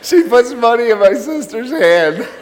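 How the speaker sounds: laughing through the words in disbelief; can barely get it out for laughing; real laughter breaking into the line. Real, not acted.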